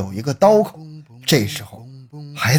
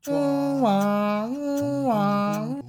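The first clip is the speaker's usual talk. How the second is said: high slow wailing mouth siren; fire engine siren; 9 semitones higher, 8 dB quieter, much slower than usual. Acted. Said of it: "消防车也来了".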